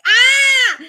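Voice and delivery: a sudden high, loud cry of shock; sharp high alarmed shout; acted startled yell. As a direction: yelp as the character is jolted awake. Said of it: "goes like".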